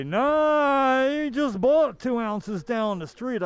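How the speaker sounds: high, loud mock store clerk; imagined clerk. Imagined dispensary clerk refusing a buyer, high and loud. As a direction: play a fussy clerk refusing a sale.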